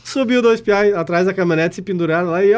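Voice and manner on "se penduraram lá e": ordinary delivery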